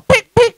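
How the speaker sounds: high mock shutter clicks; camera click sound. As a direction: mimic a phone camera snapping. Imitating camera clicks for content.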